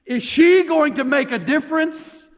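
ordinary talk, nothing marked

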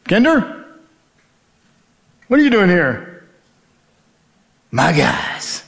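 retold cry of surprised recognition; surprised colleague calling out. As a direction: imitate a coworker's disbelief on spotting a friend. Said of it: "I hear someone say my last name".

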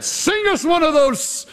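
high-pitched mocking demands; jeering captor. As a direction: taunt the prisoners into singing hymns. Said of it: "One of our captors said".

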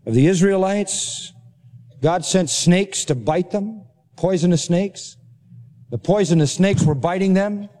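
ordinary delivery

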